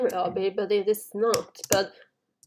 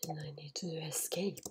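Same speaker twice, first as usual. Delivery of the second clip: very soft, quick whisper; 6 semitones lower, 16 dB quieter, faster than usual. Real, not acted. Player needs to escape unnoticed, whispering. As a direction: whisper it under your breath. Sneaking toward the exit.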